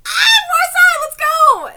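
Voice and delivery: high, loud fangirl cheer; excited outburst. Real, not acted. Fan interrupting the manga reading to cheer, excited.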